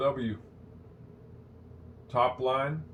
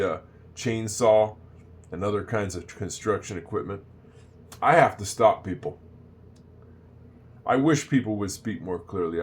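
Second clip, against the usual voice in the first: ordinary talk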